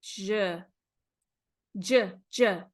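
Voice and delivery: J, j, j repeated with the tongue a little forward; teacher drilling the J sound; J sound demo. Shown as a demonstration. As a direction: tap out j, j, j crisply. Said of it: "it's a little forward".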